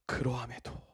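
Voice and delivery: 그러함에도 breathed in a low whisper; whispered word. Shown as a demonstration. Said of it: "이 한 단어가 있어야 돼요". (그러함에도를 낮게 속삭이듯)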